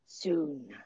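low, soft sinister murmur; skeevy little guy. Retold or imitated. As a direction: breathe it like a lurking schemer. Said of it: "one of those skeevy little guys who just goes".

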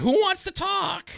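very high, drawn-out call; slow, high-pitched mocking plea for chat; mock attention-seeker. Podcaster imitating messenger sign-in pests, high mock-needy call.

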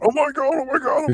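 squealing oh my God, pitch soaring up; shrieking jackpot winner. Pretend. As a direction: shriek it like you just won big. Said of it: "It'd be like".

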